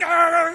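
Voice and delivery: sudden high yelp of a man being shocked; retold scream of pain. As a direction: yelp high and loud, as if jolted. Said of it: "And he's like".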